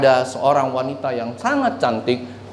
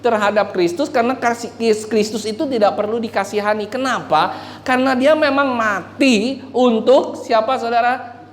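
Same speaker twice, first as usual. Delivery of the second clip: raised, driving sermon voice; fervent preaching; 8 semitones higher than usual. Acted. Preacher urging the church in full fervour. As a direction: preach it hard to the congregation.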